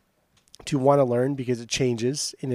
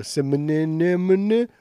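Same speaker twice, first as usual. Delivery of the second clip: drawn-out, higher mock kid voice; little kid struggling; 4 semitones higher, 4 dB louder, much slower than usual. Co-host mocking how little kids say it, childish stumbling. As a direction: garble "Cinnamon" with extra syllables like a small child. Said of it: "when little kids try and do it".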